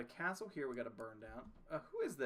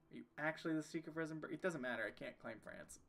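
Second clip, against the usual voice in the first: usual speaking voice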